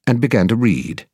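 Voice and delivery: usual speaking voice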